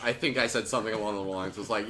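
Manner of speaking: ordinary talk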